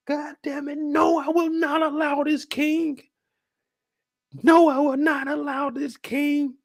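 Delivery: high, heated protest; high worked-up rant; outraged lord. Acted. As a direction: plead with the king in a high outraged voice. Acting the outraged Corlys.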